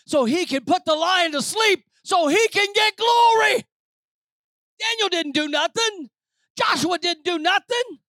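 high, shouted sermon build; rally the congregation with a loud, high cry; preaching at full force. Real, not acted. Preacher telling church the victory is the Lord's, full-force shout.

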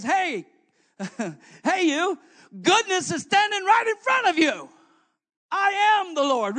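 high, loud preaching as Jesus. Acted. Preacher voicing Jesus to the young ruler. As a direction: proclaim it boldly.

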